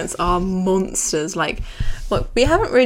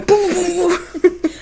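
punching noises; 9 semitones higher, 4 dB louder, slower than usual. Retold or imitated. Imitating Mike punching.

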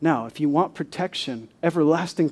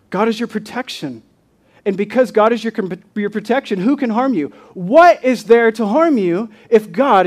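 raised, emphatic preacher's voice; impassioned preaching; 5 semitones higher, 7 dB louder than usual. Real, not acted. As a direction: fire rhetorical questions out at the congregation. Preaches at full force.

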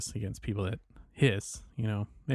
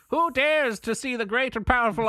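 grandiose gatekeeper voice; 13 semitones higher, 6 dB louder than usual. Acted. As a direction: announce it like a pompous guardian. Player mocks grand gatekeeper.

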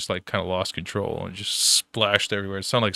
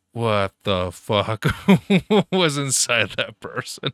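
drawn-out, louder mock outrage breaking into laughter; laughing disbelief; 2 semitones higher, 6 dB louder, slower than usual. Asking his buddy about the patient, laughing disbelief. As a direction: stretch the question out, laughing midway.

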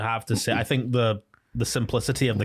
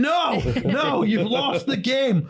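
squeaky, much higher sulky insistence; high mock Jigsaw; 13 semitones higher, slower than usual. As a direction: whine that you still won. Jigsaw insisting he won anyway.

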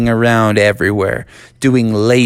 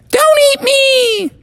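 very high, loud squeaky cry; screaming candy bar; 4 dB louder, slower than usual. Acted. Candy bar pleading again, high squeaky crying shout. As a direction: shriek the plea again, louder. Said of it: "the voice repeated".

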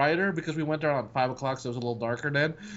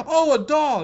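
put-on excited girl voice; 10 semitones higher, 6 dB louder, slower than usual. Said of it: "she's like".

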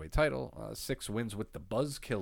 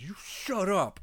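mock yell; 12 semitones higher, 5 dB louder, slower than usual. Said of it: "points to somebody in the crowd and says".

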